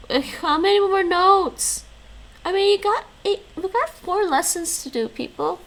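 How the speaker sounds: high, loud mock exasperation at the notes; high exasperated. Real, not acted. Streamer complains to chat about remaining lessons, loud and high.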